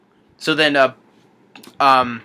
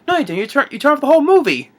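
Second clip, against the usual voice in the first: higher-pitched retold scolding; mock exasperation; 12 semitones higher, 5 dB louder, faster than usual. Retelling a film scene to viewers, mock exasperated. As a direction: act out a comic, rising complaint.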